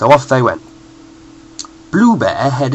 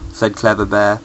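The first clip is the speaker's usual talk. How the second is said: usual speaking voice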